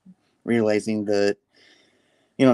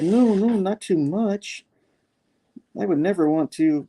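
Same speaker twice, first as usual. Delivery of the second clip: acted higher-voiced reassurance; earnest protest; 10 semitones higher than usual. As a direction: protest gently, pitched well up. Peter earnestly protests to Norman.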